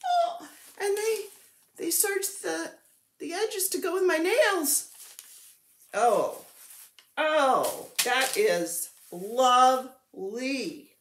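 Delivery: real delight. Real, not acted. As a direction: gush over every detail. Delighted by fabric.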